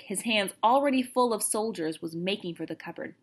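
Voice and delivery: plain reading voice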